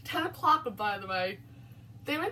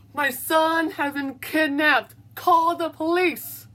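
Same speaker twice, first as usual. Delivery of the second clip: loud, urgent shout to the neighbors; panicked father shouting for help; desperate shout; 9 dB louder than usual. A narrator's reading. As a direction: yell it so the whole street hears. Father begs neighbors for police.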